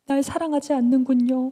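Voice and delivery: high, loud lament; acted wounded-lover complaint; nagging reproach. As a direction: whine it to make the listener give in. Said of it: "따라다니면서 막 졸랐어요".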